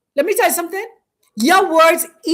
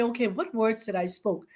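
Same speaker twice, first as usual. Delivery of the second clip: quiet self-question; 5 semitones lower, 12 dB quieter than usual. Pretend.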